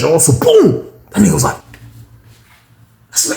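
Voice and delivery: ordinary talk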